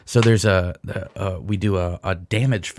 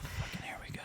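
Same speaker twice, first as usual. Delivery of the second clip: muttered whisper aside; 22 dB quieter, faster than usual. Real, not acted. Friend teases the crush talk in a whisper. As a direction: whisper it, exasperated.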